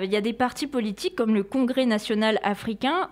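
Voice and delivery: normal talking voice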